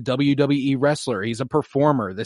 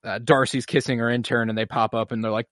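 usual speaking voice

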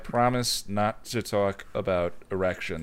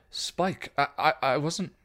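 desperate Dawn; 5 semitones higher, slower than usual. Acted. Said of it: "she tried, desperate".

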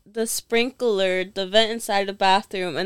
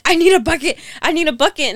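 raised, urgent voice repeating the plea; 7 semitones higher, 5 dB louder than usual. Retold or imitated. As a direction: call out for a bucket in a panic. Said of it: "And she's like".

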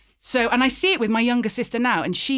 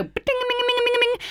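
high, fast sing-song chiming noise; cartoon sparkle sound effect; imitated eye-twinkle noise; much faster than usual. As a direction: rattle off a high twinkly ringing. Imitated twinkle of piercing eyes.

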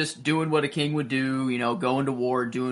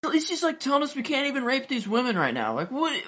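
much higher, whiny put-on voice for a complaining warrior; whiny Dothraki warrior; 10 semitones higher than usual. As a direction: pitch it way up like a sulky soldier.

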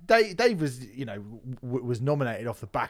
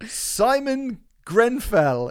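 slow, higher, louder hammed-up host announcement; mock presenter; 9 semitones higher, 5 dB louder, slower than usual. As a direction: drag out each name like a cheesy host. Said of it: "It was like, what?".

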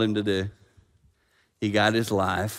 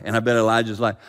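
ordinary delivery

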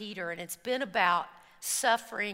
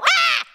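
loud high mock roar; a big loud yell to scare off a bear; 12 dB louder than usual. Hikers yelling together at the bear, loud mock roar. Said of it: "and we go".

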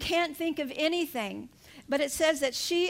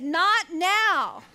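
louder retold refusal, falling hard at the end; acted protest; 4 semitones higher, 6 dB louder than usual. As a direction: push it away with mock force. Acts out her grieving protest.

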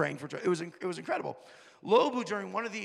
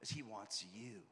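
tender appeal; 7 semitones lower, 9 dB quieter than usual. Retold or imitated. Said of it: "I go".

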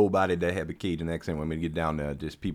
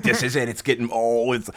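high, loud mock anticipation; excited retelling; 6 semitones higher, 6 dB louder than usual. Traveller finally hearing a little Cajun accent, excited. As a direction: act out breaking into eager excitement.

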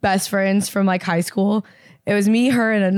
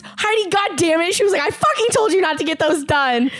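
higher exasperated scolding; scolding mom; 6 semitones higher than usual. Retold or imitated. Mom scolding daughter over piercing, exasperated. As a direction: tell her off like a fed-up mother.

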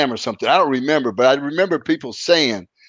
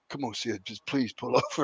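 much softer, hushed begging; quiet pleading; 12 dB quieter than usual. Retold or imitated. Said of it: "he's saying it to me quietly".

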